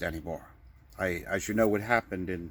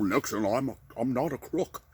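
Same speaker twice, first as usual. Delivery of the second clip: Nixon. Acted. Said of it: "there was Clinton, and then".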